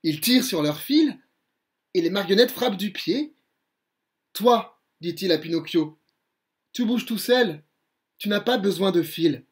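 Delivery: put-on lively read-aloud for children; a bedtime-story voice that acts out every character; storybook narration. A narrator's reading. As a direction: tell the tale with colour and energy.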